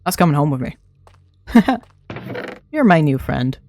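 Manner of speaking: normal talking voice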